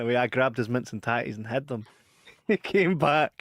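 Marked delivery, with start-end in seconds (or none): none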